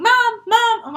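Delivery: high, loud searching call; calling for Mom. Acted. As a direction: call out for a mother who isn't answering. Child calls around the house.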